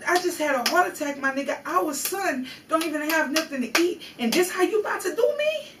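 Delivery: retold inner protest, rising in pitch; hurt disbelief. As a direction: plead your case in aggrieved disbelief. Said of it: "in my brain, I'm like".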